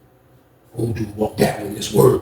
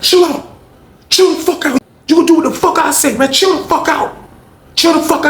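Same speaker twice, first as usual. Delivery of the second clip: high-pitched, exasperated command; acted forceful order; 12 semitones higher than usual. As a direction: lay down the law in a raised pitch. Lamont dominates in fight.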